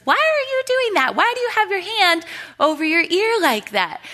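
hammed-up mom demanding an explanation; puzzled mom. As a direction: play the exasperated mother asking what on earth he's doing. Mom questions son mid-scolding.

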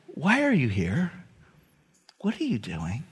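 soft and low, fading to almost nothing; a tender whispered question to the heart; whispering Spirit. Acted. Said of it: "his Spirit whispering to your heart".

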